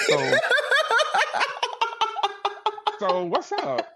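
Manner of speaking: can't hold it together while quoting; laughing through it. Real, not acted. Storyteller cracking up while reenacting his own reply, laughing hard.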